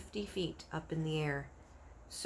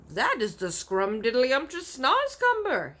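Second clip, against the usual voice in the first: higher, slower, delighted giant; friendly giant voice; 7 semitones higher, 7 dB louder than usual. Acted. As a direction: savour every word like a friendly giant showing off. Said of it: "he said".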